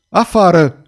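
high, loud command; angry yell. A narrator's reading. Furious man orders the girl out, angry yell. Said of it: "urlă el".

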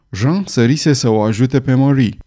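plain reading voice

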